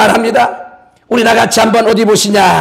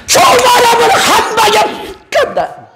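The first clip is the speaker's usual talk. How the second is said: much higher, slow, half-laughing chant; call-and-repeat line; 13 semitones higher, slower than usual. Shown as a demonstration. Preacher leading the congregation to repeat, high slow chant. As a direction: sing it out high and slow for the crowd to echo. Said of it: "한번 저 따라해 주세요".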